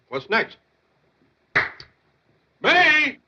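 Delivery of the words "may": high, raised bellow. Acted. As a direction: bellow for someone in another room. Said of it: "you don't have to bellow".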